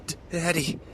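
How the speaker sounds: put-on stuttering, scared child; frightened stammer. A narrator's reading. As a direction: stammer out the word in fear. Terrified boy stammers.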